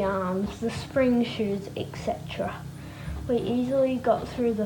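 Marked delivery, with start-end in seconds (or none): 1.7-2.5 whisper. A narrator's reading. Offhand list end.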